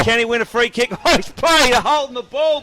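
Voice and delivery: live footy commentator's excited outburst; excited call. Real, not acted. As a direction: raise the call sharply as the free kick is paid. Free kick decision shocks caller.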